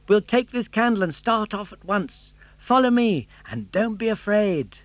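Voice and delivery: put-on high, bright puppet boy voice; Pinocchio. Puppet boy urging his father, high and eager.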